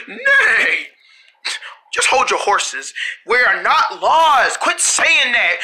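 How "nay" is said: retold neigh. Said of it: "so I said".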